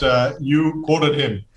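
ordinary delivery

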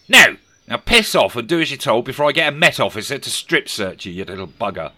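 very loud no, then a snarled threat; angry shout. A narrator's reading. Scrooge refusing the boy a tip, angry shout. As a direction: blast the boy away.

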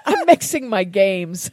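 laughing through the words. Real, not acted. Host laughs at mixing up Jeopardy and bingo. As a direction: giggle while owning the mixup. Laughs at her game mixup.

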